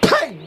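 short, high exclaimed bang noise; imitated bang. Driver imitates the engine breaking down, sudden bang.

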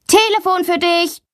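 high, sing-out call. A narrator's reading. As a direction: announce a phone call to your sister. Brother calls her to phone.

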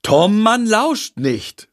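slow, high sing-out reprimand; admonishing. Acted. Freya correcting Tom from another room, admonishing. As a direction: chide a child from afar, drawing it out.

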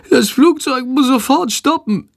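very high, suffering creature voice; moaning. A narrator's reading. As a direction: groan it in a tiny animal's voice. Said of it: "stöhnt Shandor". (stöhnend, mit sehr hoher Stimme)

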